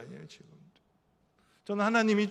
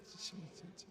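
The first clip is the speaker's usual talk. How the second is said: whispered, much softer; 23 dB quieter, much slower than usual. Real, not acted. Preacher whispering a challenge to the congregation. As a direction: breathe the word as a private challenge.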